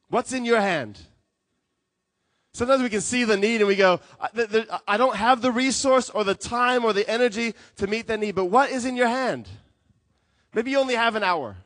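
high, loud challenge to the congregation; impassioned sermon with punched phrases; forceful preaching. Real, not acted. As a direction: press each point on the listeners.

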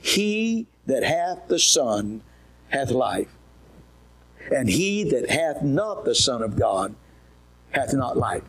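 each one-syllable word laid out slowly; slow deliberate reading. Shown as a demonstration. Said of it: "one syllable explanation".

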